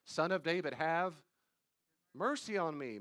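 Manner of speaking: loud, desperate plea for mercy; crying out for mercy. Retold or imitated. Blind man calling out to Jesus for mercy, loud plea. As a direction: call out loudly, begging to be heard.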